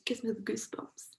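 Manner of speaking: breathy awed whisper; hushed goosebumps whisper. Real, not acted. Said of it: "It, like, gives me".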